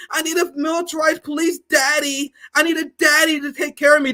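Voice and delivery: high, whiny mock crybaby. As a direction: whine it like a scared crybaby. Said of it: "the most beta shit you can do".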